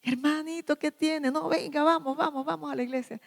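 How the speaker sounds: high-pitched, gentle cajoling; coaxing encouragement. Acted. Church member urging a brother to come along, coaxing encouragement. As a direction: coax a reluctant friend along, voice lifted. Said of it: "El de animar al otro".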